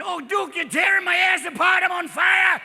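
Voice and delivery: put-on high, loud, squawking old-timer yelp; cackling sidekick in pain; hammed-up old cowboy wail; Walter Brennan. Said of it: "Walter Brennan".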